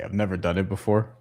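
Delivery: ordinary delivery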